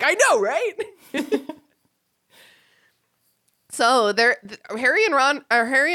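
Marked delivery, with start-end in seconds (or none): 0.0-1.4 laughing, a bit higher, friend-to-friend; laughing agreement. Retold or imitated. Friend laughs along while agreeing that it sucks. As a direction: laugh through it as you agree. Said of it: "And you're like".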